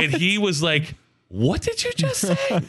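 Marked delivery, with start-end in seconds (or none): 1.3-2.6 very high, slow mock disbelief; shocked retelling. Preacher hearing a swear word, high-pitched mock shock. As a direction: squeak it out like a scandalized preacher.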